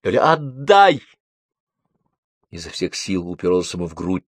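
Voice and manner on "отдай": slow, higher-pitched cry; hateful cry. A narrator's reading. Lyolya demands the photo from Bibichev, crying out. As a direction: draw the demand out in protest. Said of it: "Лёля кричала, ненавидя".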